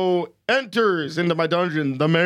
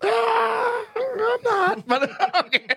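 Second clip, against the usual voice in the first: laughing; much slower than usual. Real, not acted. Laughing reaction to epic heroes.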